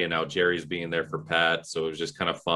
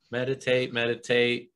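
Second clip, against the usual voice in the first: higher, monotone mock chant; pretend meditating; 5 semitones higher than usual. Kids pretending to meditate to hide their digging, mock chanting. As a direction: fake a meditation chant as cover. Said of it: "pretended to be meditating".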